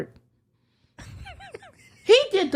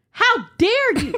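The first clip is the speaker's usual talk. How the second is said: mock outrage; 6 semitones higher, slower than usual.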